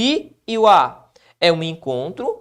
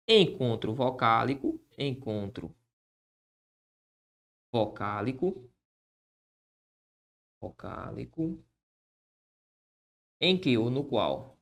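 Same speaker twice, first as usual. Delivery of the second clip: slow, soft dictation to the board; dictating while writing; 8 semitones lower, 9 dB quieter, slower than usual. Shown as a demonstration. Teacher dictating the rule as he writes it, slow and soft. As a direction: spell it out slowly as you write.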